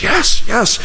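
very high-pitched tearful imitation; imitated weeping. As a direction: mimic her weeping assent way up high. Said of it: "she's weeping, and she goes".

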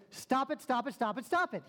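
rapid-fire repeated yell, high and loud; hammering shouted command. Retold or imitated. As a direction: imitate an exasperated therapist yelling. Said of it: "He yells at her".

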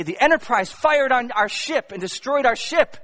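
angry Klingon. Retold or imitated. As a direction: bark the charge like an outraged Klingon. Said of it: "the Klingons say".

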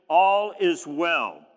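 each word drawn out with calm certainty; slow, measured faith-filled reply; slow and deliberate. Acted. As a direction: let All is well land slowly and firmly. Mother's steady reply, repeated.

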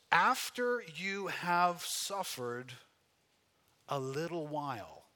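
very slow verse reading, pause before "a little while"; deliberate, emphatic scripture reading; slow, weighty reading. Real, not acted. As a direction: stretch each word of "After you have suffered". Reads verse slowly, then repeats.

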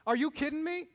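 a mock-incredulous question, tongue in cheek; what Jesus does not say, with rising disbelief; indignant outburst. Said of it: "Is that what Jesus says?".

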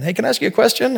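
a hint of a little girl piping up to ask; child asking. Daughter interrupting her busy dad, a lightly mimicked child's question.

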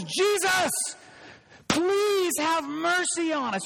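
acted scream for help, full of passion; urgent crying out. Shown as a demonstration. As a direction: cry out for mercy with stretched words. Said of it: "Do you sense the passion?".

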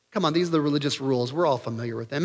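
ordinary talk, nothing marked

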